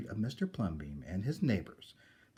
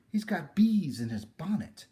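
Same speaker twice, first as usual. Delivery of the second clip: mocking exclaim; 7 semitones higher, 8 dB louder than usual. Townspeople mocking the neighbour.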